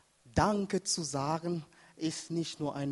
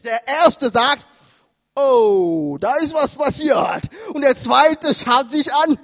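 loud, raised-pitch sermon delivery; full-force preaching; 7 semitones higher, 10 dB louder than usual. Acted. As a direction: drive each point home to the congregation.

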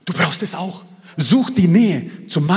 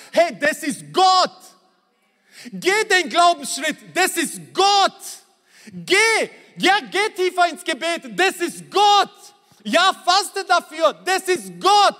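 climactic preaching shout; 13 semitones higher, slower than usual. Acted. Pastor urging believers to step out in faith, shouting. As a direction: call them forward with full force.

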